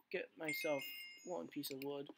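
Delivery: ordinary delivery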